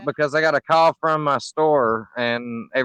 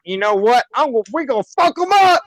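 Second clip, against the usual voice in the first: hyped battle cry; 7 semitones higher, 3 dB louder than usual. Retold or imitated. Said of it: "I'm gonna be like".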